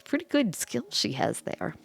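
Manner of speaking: plain reading voice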